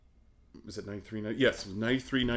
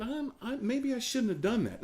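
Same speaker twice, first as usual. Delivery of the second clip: nervous inner thought; 14 semitones higher than usual. Retold or imitated. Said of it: "you're thinking".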